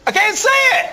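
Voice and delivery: high, loud helpless whine; mock husband yelling he can't see it. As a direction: protest like a man who truly can't find it. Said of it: "And you go".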